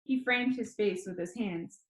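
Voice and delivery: ordinary delivery, nothing marked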